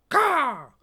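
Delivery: high, loud raven squawk; sharp bird call; raven caw. Acted. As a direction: caw like a crow. Raven Anna interrupts.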